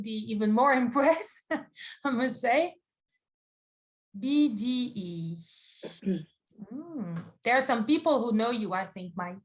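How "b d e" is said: ordinary delivery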